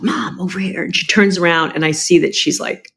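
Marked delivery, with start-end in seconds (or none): 0.0-0.8 hushed urgent call to mom across the hallway; calling softly but urgently; whisper-yell. Retold or imitated. In the hospital hallway calling to mom down the hall, hushed whisper-yell. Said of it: "I kind of whisper, yell to her".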